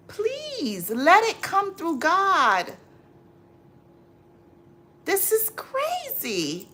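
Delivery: slow, high, strained plea to let it go; exasperated pleading. Real, not acted. To a viewer chasing her money, exasperated high pleading. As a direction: beg her to let God handle it, drawn out and high.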